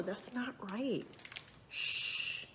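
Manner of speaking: put-on hushed child's whisper; whispered protest. Audrey whispering to her mama during the testimonies. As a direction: say it under your breath in church.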